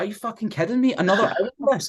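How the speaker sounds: high, outraged mock disbelief. After aching through fives, he reacts to another hour in mock disbelief. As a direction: act out the horror at another hour. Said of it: "I'm like".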